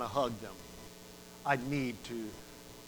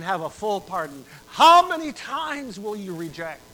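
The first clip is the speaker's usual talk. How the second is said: impassioned sermon climax; preaching at full force; 4 semitones higher, 8 dB louder than usual. Acted. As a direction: drive the appeal home. Preacher builds to appeal.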